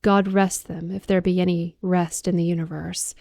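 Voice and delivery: plain reading voice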